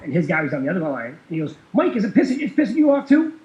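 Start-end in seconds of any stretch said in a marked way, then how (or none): 1.7-3.3 high, loud mock-outraged question; going off. Banker asks his guy on the other line, mock outraged. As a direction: imitate an outraged banker seeking backup. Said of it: "he was kinda going off".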